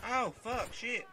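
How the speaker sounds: high, loud startled curse; panicked shout. Real, not acted. Gamer caught by surprise fire, panicked shout. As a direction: blurt it out as you get hit. Sudden danger in game.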